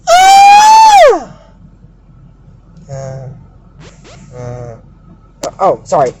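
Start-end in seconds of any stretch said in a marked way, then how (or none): 0.1-1.4 high-pitched mock scream. Recapper reacts to her own cliffhanger, high mock scream. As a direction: squeal in mock horror at the cliffhanger.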